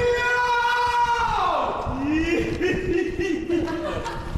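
high, drawn-out cackle; squealing fit of laughter; long shrieking laugh. Real, not acted. Group laughing at game antics.

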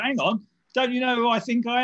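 hammed-up "don't you know who I am" protest; mock indignant. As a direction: play the outraged VIP being refused. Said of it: "It's like".

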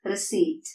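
"receipt" with silent P; correct pronunciation. Shown as a demonstration. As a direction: say "receipt" as re-SEET. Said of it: "ഇവിടെ പി silent ആണ്". ("receipt" ശരിയായി, "പി" ഇല്ലാതെ)

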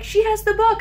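high, excited fan call. Retold or imitated. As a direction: point it out to him, thrilled. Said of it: "And she was like".

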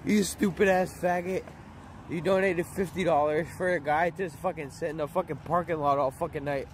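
ordinary talk, nothing marked

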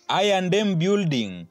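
normal talking voice

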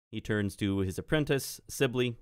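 ordinary talk, nothing marked

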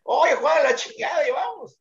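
high urgent yell across the court; frantic high call to a teammate; shout. Retold or imitated. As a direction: shout at Juan to hurry back. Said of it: "y yo estaba".

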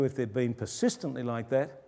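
ordinary delivery

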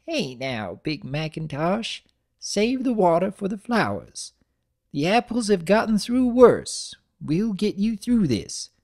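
put-on high, creaky elderly mare; an old granny fussing; lightly hammed-up old grandmother. A narrator's reading. Granny comforts.

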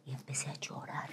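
whispered confession; quiet emotional recall; hushed. Real, not acted. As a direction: drop your voice remembering tears. Telling she started crying.

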